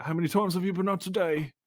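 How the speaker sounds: high-pitched caricature British policeman; British cop send-up, high voice; mock British accent. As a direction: put on an exaggerated British accent, pitched right up. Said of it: "that's an accurate British accent".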